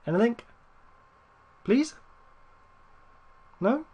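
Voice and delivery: high, sharply rising little questions; tentative begging lilt; hopeful pleading. Real, not acted. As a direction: plead upward, hoping for any response. Asking the game for a response.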